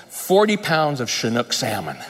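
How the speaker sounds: ordinary delivery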